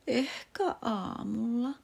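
soft, slow, drowsy murmur; sleepy. Acted. Drowsy boy promising himself, half asleep. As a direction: let sleep take the words.

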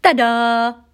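loud, high ta-da fanfare; dad proudly presenting the found cap; triumphant exclaim. Acted. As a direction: announce it like a magician. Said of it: "isä huudahti".

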